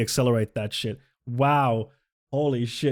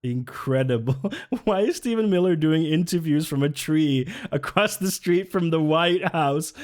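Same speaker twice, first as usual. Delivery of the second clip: ordinary talk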